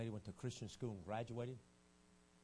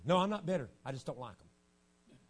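mock denial; 4 semitones higher, 10 dB louder than usual. Mimicked churchgoer.

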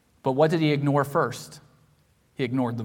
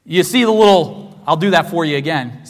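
usual speaking voice

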